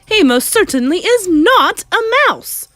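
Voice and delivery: high, loud, emphatic denial; furious shout. Acted. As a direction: shout down the very idea. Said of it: "shouted Mister Jenkins".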